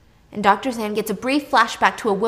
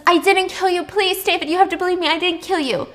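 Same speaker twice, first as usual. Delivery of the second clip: much higher and louder, begging; desperate pleading; 9 semitones higher, 5 dB louder than usual. Retold or imitated. Anna begging David as she's dragged away, high and loud. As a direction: cry out that you're innocent. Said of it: "and she says".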